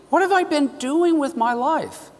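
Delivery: high startled cry of realization; a woman struck by the truth, voice leaping up; sudden realization. Retold or imitated. Driver realizing her wasted life, startled high cry.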